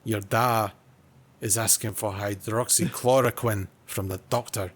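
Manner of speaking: put-on Scottish storytelling; mock Scottish character voice; Scottish accent. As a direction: relate it in amateur Scottish. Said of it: "I could probably try a bit of Scottish".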